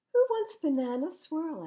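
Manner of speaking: cheerful offer. Acted. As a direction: sing it out like serving a treat. Mom offers dessert.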